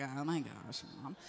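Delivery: low, quiet embarrassed groan; eye-rolling kids. Acted. Kids embarrassed by their mom, imitated eye-rolling groan. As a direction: mutter it like a mortified teen. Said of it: "rolling their eyes".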